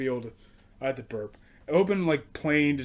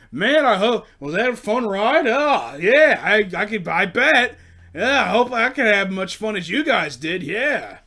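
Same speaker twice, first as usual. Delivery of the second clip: loud, higher eager voice; mocking eager man; 5 semitones higher, 7 dB louder than usual. Comic mocking an eager man in line, loud and chirpy. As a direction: play the over-keen old guy.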